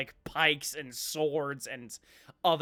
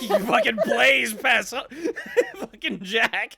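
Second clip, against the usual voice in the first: talking through laughter; laughing; 9 semitones higher, 11 dB louder than usual. Real, not acted. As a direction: laugh while shouting. GM laughing mid-sentence.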